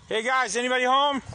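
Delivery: loud call toward the house. Real, not acted. Calling out at a house.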